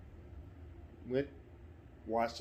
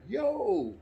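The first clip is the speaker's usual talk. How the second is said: high startled "Yo!"; alarmed shout; 13 semitones higher, 6 dB louder than usual. Retold or imitated. Son sees his dad on the ground, startled high shout. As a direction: call out in shock.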